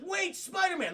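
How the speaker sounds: high-pitched urgent call; calling after. Acted. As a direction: call after someone getting away. Police call after fleeing hero.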